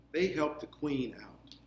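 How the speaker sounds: usual speaking voice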